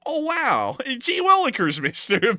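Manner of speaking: put-on high, loud gee-whiz kid voice; wholesome 1950s kid. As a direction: gush like an old-timey boy. Hammy exclamation at canyon photo.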